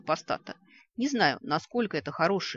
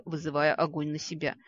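usual speaking voice

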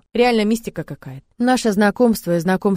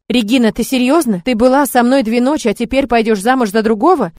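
loud, higher hurt accusation; jealous lover confronting Regina loudly; angry outburst; 4 semitones higher, 8 dB louder than usual. Acted. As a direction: throw the betrayal back at her.

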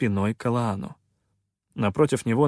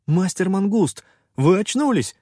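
high-pitched, delighted outburst; joyful exclamation; 9 semitones higher, 5 dB louder than usual. A narrator's reading. Попрыгун greets the revived master, joyful exclamation. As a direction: cry out with glad surprise. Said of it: "радостно воскликнул".

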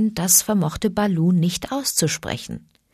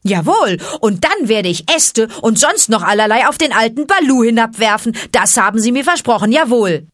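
loud, higher-pitched defiant crowing; full-throated boast; 5 semitones higher, 6 dB louder than usual. A narrator's reading. Boy threatening to pelt the old bear, full-throated boast. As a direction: boast excitedly at the top of your voice. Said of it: "rief er aus vollem Halse".

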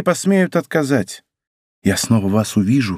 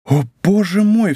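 cry of horror; 7 semitones higher than usual. A narrator's reading. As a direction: gasp it out in sudden fear. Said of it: "вскричала Анна Австрийская с выражением ужаса". (королева в ужасе восклицает — высокий испуганный вскрик)

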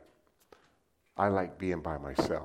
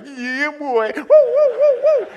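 loud high joyous whoop; gleeful siren-whoop while driving; 19 dB louder, slower than usual. Retold or imitated. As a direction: yell "Yeah, boy" like a thrill ride. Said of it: "I was like".

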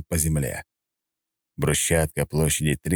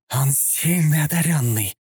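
high, slow hissed warning; hissing; 9 semitones higher than usual. A narrator's reading. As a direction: hiss it like an assassin. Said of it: "прошипела одна из нападавших".

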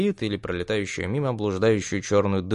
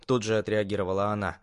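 usual speaking voice

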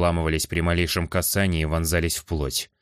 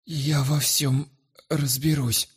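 frightened wheeze; 8 semitones higher, much slower than usual. Acted. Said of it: "просипел".

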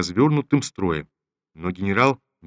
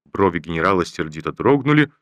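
usual speaking voice

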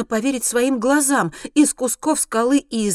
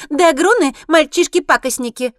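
high, loud squeaky harpy; taunting shrill creature voice; 7 semitones higher, 10 dB louder than usual. A narrator's reading. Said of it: "подхватывает чернокрылая".

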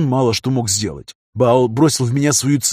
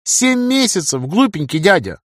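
put-on high teasing child voice; teasing little girl; 7 semitones higher than usual. A narrator's reading. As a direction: tease him like a little girl. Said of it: "ответила она".

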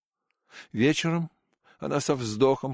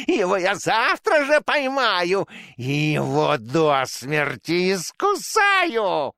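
high, loud, slow hissing mouse king; chittering insect creature; 8 semitones higher, 5 dB louder, slower than usual. A narrator's reading. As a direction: hiss the threats like a rodent king. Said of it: "прошипел на ухо".

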